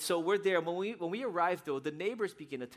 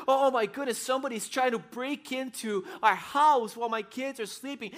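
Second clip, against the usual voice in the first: higher, louder panicked mom, acted out; alarmed mother; 8 semitones higher, 6 dB louder than usual. Mom realizing someone is breaking in, alarmed. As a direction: cry out in mock motherly alarm. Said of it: "the motherly thing just took over her".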